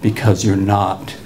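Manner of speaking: low, slow, gentle warning; lightly voiced protective teacher. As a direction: drop down and lay it on slowly, kindly. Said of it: "he says, in the same tone".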